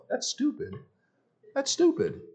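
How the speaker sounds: soft, very high squeak; high mock dismissal. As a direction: squeak out a dismissive inner thought. Mocking his own dismissive thought.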